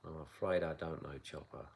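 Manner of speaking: ordinary talk, nothing marked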